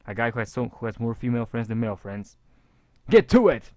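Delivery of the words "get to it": shout. Pretend. Shouting at viewers as a joke.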